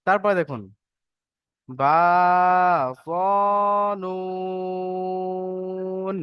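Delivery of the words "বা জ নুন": বা and জ held long, নুন held far longer on a level note; one- and three-alif stretches. Shown as a demonstration. Teacher models letter lengths for students, longest stretch on নুন. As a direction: stretch বা and জ one beat each, then draw নুন out for three. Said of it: "নুনে তিন আলিফ টান".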